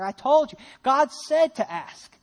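loud, exasperated prophet's reminder; slow down to drive it home, exasperated; acted frustration. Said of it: "Isaiah gets kind of frustrated".

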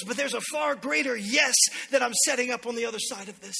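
loud, high, impassioned sermon delivery; full-force preaching. Real, not acted. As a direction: proclaim it to the whole church.

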